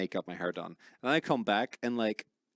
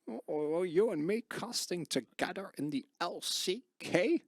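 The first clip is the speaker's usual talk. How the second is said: put-on old-timer reminiscing; 8 semitones higher, 3 dB quieter than usual. Mock nostalgic reminiscing.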